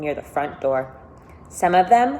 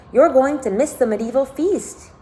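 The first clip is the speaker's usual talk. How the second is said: higher, louder call after her; 7 semitones higher, 4 dB louder than usual. Acted. Sam calls after Caroline, trying to make her smile, calling out. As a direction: call out to get her back. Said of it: "he called after Caroline".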